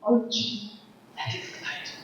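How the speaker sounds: softly whispered self-talk; whispering to herself to let the insult go. Retold or imitated. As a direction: hush it to yourself to calm down. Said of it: "self-talk whisper".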